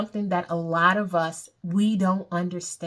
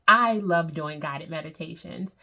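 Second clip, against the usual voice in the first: ordinary talk, nothing marked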